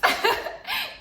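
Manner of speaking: short, very high giggle; real high-pitched cackle; gleeful laugh. Real, not acted. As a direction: burst into a squeal of laughter. Laughing between two yeses.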